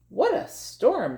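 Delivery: high chirpy bird voice; twittering wren. Acted. Reading the wren's line to a child, high twitter. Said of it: "twitters wren".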